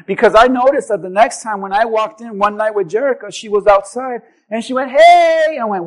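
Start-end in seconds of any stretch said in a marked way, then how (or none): 4.9-5.6 bright, louder hey across the yard; cheerful call. Retold or imitated. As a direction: call out happily to a neighbor. Neighbor calls out cheerfully.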